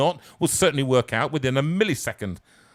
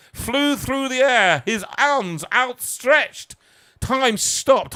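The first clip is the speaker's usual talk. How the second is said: higher, louder, drawn-out slow-motion telling; mounting drama; 7 semitones higher, 3 dB louder, slower than usual. A narrator's reading. Narrating the near accident, building drama and slow pace. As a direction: tell it like a slow-motion action scene.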